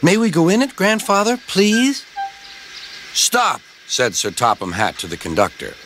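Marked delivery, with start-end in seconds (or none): 0.0-2.0 put-on high, eager child begging; pleading child. A narrator's reading. Children plead with grandfather in story. 3.1-3.6 high-pitched call to halt. A narrator's reading. Sir Topham Hatt calls to conductor.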